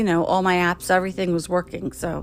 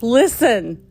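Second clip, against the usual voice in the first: louder, higher, sharp retold protest; fed-up outburst; 6 semitones higher, 4 dB louder than usual. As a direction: tell the agent you're done. Fed up with support agent.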